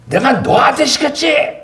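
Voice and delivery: high, slow mock shout of an angry pastor; a pastor yelling at a young helper mid-service, imitated high shout. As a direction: bellow the order from the pulpit, hammed-up. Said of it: "소리 지르시더라고요".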